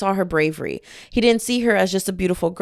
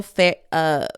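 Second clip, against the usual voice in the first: ordinary delivery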